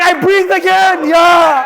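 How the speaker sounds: high, slow hyped-up shout; excited shout. Retold or imitated. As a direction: yell it like you just won. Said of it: "Sina's like".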